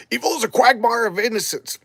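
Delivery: high, declamatory delivery; grand mock oration; theatrical defiance. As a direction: proclaim it like a hammed-up hero. Acting Doctor Fate's defiant answer.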